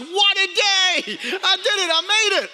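proud new father's elated shout; triumphant joy. Retold or imitated. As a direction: celebrate like you just did it. Triumphant moment at birth.

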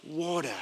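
water said softly as "waw-tuh"; low, soft "water" with no final r; non-American water. Shown as a demonstration. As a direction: lead the room in a soft, rounded "water". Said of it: "all say it together".

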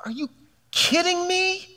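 high, incredulous pleading; God stunned by what we settle for; God exasperated. Retold or imitated. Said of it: "It's like".

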